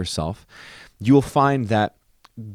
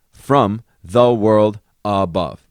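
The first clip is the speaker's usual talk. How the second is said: slow, weighed reading of from the world above; slow deliberate reading; 2 semitones lower, 4 dB louder, slower than usual. Shown as a demonstration. Composer slowly reading text to count syllables.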